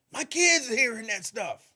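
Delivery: loud, high angry caller; heated complaint. Retold or imitated. Clyde calling the station about the false report, heated complaint. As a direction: complain hotly as the upset caller. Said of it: "He was heated".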